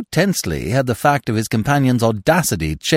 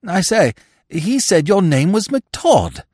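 put-on high, loud, slow puzzled young fool; high-voiced silly-ass character, confused; baffled Freddy; 8 semitones higher, 5 dB louder, slower than usual. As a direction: blurt it out in high-pitched bewilderment. Baffled Freddy questions impostor.

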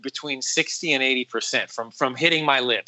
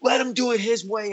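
high-pitched mock anger; mad, retold; 9 semitones higher than usual. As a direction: imitate a mad boss giving orders, pitched up. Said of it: "and he's mad".

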